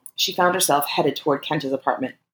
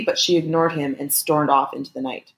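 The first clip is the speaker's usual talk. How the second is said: ordinary delivery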